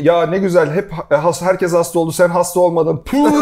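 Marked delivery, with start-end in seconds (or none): 3.1-3.4 imitating a dismissive puff; mock spit sound.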